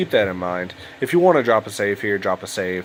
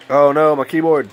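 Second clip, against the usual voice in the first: louder, higher oh no of dismay; dismayed outburst; 6 semitones higher, 7 dB louder than usual. Real, not acted. Gamer reacts as his keyboard acts up, dismayed. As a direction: react to something breaking, loud.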